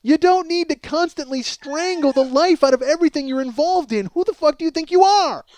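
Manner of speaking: usual speaking voice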